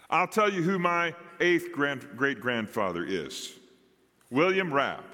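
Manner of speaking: usual speaking voice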